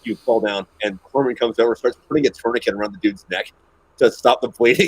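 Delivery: usual speaking voice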